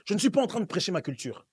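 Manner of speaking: ordinary talk, nothing marked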